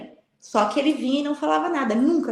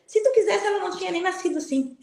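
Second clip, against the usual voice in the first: retold angry quarrel; 7 semitones higher than usual. Woman retelling how she argued with Jesus, indignant. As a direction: throw the accusation back at him, indignant.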